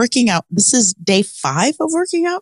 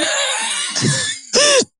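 high laughing yelp; retold scream dissolving into a laugh; mock scream into laugh; 3 dB quieter than usual. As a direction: burst from a fake scream into laughter. Retelling the sit-up scream.

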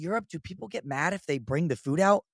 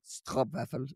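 "Stroopwafel" low and soft, deadpan; 5 semitones lower, 8 dB quieter, slower than usual. Retold or imitated. Mocking his own Dutch answer, low and deadpan. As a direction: drop "Stroopwafel" flat and low as a gag. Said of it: "I just thought that was the funniest".

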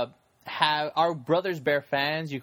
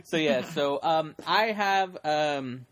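ordinary talk

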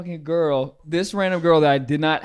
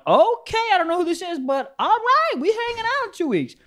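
squeaky-high mock excitement; giddy agreement. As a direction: act out an over-eager yes, way up high. Acting out own eager text reply.

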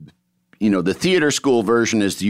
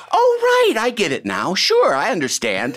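high, lightly voiced agreement; breezy feigned understanding; mock agreeable; 10 semitones higher than usual. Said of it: "every time Terrence Stamp, like".

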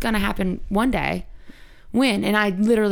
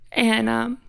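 ordinary delivery

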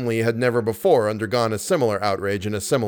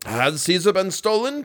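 higher, alarmed question; cried out; 7 semitones higher than usual. A narrator's reading. Acting manager learns of the theft, crying out. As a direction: ask it in shocked dismay. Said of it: "cried the acting manager".

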